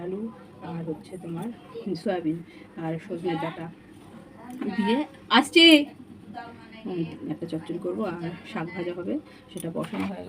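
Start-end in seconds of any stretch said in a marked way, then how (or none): 4.8-5.6 loud shout to another room; calling out. Real, not acted. Mother in the kitchen answering someone at home, calling out. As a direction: call back that you're on the way.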